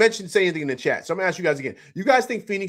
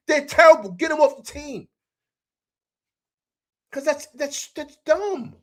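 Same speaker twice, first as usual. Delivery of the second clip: high-pitched, incredulous outrage; exasperated rant; 11 semitones higher, 3 dB louder than usual. Real, not acted. Host ranting to viewers about bad defense, exasperated and high. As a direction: rail at the dumb play, voice pushed way up.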